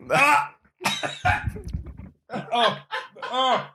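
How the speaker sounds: real pained groans, high and strained. Real, not acted. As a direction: gasp through a burning mouth. Burning mouth from hot gummy.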